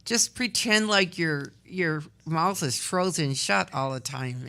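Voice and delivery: ordinary talk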